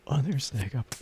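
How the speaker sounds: usual speaking voice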